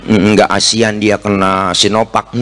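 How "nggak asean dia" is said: ordinary talk, nothing marked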